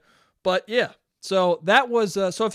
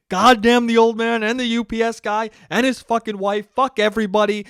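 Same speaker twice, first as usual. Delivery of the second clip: louder hammed-up curse at everyone; mock fury over the broken console; mock rant; 3 semitones higher, 6 dB louder than usual. Joking rant to listeners about the broken PS5, hammed-up loud curse.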